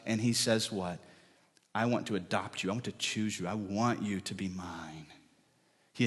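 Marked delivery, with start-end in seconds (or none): 1.7-5.1 gentle, tender. Acted. God's gentle voice quoted.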